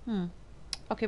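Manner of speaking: ordinary delivery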